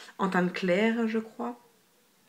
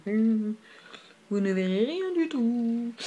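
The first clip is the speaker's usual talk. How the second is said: very drawn-out, teasing singsong; playful resignation; 3 semitones higher, much slower than usual. Real, not acted. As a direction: stretch it out in a playful lilt. Joking after a failed swatch. (ton chantant et taquin, très étiré)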